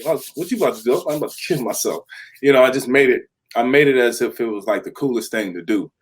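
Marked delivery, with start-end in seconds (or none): 0.0-2.0 hyped-up self talk. Retold or imitated. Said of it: "get hyped when they're about to fight".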